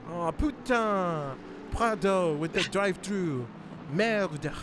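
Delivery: slow mock-French exclaiming; French accent. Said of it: "I blame Loic for teaching me".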